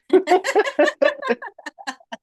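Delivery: bright burst of laughter; laughing at the till-death joke; high laugh. Real, not acted. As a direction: laugh it off lightly. Laughs at her own joke.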